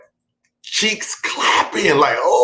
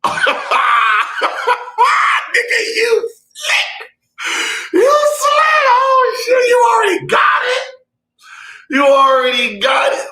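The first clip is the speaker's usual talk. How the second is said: calling out a buddy across the club; excited mock shout. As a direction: yell it at your friend, cracking up. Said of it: "looking at Andre Blush like".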